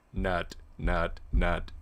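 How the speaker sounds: low, repeating robot voice. Retold or imitated. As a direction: chant nut like a robot button. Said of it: "in this robot voice".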